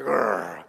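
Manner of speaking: long, very low growl; angry growl. Acted. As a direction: growl deep like a cross crowd.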